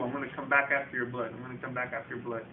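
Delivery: low, fading echo of a giant's threat; understated haunting giant voice; ringing giant voice. Retold or imitated. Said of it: "The giant voice just kept ringing through".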